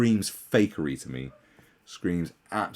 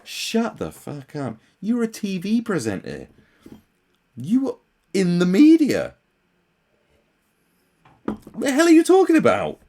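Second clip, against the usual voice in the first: building angry retort; 10 semitones higher, 7 dB louder than usual. Retold or imitated. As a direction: work up from scorn to a shout. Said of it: "You're like".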